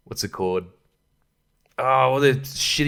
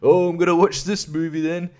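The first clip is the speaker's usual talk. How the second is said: dumb viewer; 5 semitones higher than usual. Retold or imitated. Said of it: "something where you go".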